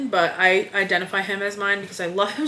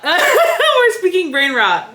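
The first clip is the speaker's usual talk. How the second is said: laughing; 8 semitones higher, 5 dB louder, much slower than usual. Real, not acted. Laughing at brain rot.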